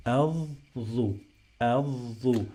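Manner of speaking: "adhu" in two parts, th held long before u; doubled TH. Shown as a demonstration. Teacher models syllables for learners, drawn-out th. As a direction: stretch the buzzing th in "adhu". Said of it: "I pronounce this T H another".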